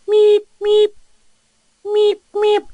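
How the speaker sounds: very high, loud electronic meeps; imitated phone ring. Phone ringing at the hotline, imitated high beeps. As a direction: squeak out a ringing tone.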